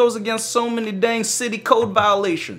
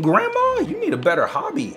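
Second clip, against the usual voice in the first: ordinary talk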